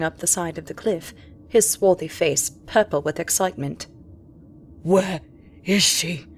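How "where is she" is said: breathless captain demanding; gasped. Acted. Captain winded from the cliff, gasping. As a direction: gasp it out, winded from the climb. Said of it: "he gasped".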